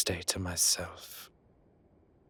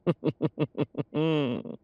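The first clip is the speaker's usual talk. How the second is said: laugh; 5 semitones higher than usual. Acted. Amused reaction to teasing.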